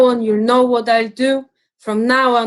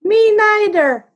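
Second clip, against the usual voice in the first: put-on high, small child voice; baby kangaroo; 12 semitones higher, 5 dB louder than usual. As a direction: squeak it like a tiny joey. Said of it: "the young kangaroo in her pouch said".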